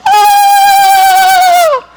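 high laughing shriek; mock terror shriek, half laughing; terrified scream. Preacher acts out terrified disciples, laughing shriek. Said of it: "They're terrified".

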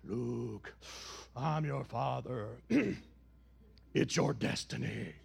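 low, slow Vader voice; deep, slow Vader drone; Darth Vader impression. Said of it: "Star Wars fans".

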